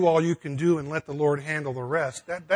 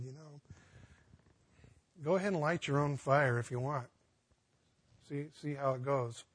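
soft dry voice of God; 8 dB quieter than usual. Retold or imitated. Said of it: "And the Lord said".

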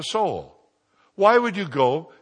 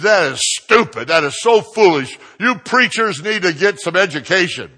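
loud, raised, scolding outburst; mad yelling; 5 semitones higher, 8 dB louder than usual. Retold or imitated. Mad mother scolding the preacher, angry yell. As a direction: tell him off at full voice. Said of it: "She's so mad".

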